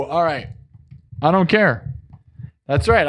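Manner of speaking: ordinary talk, nothing marked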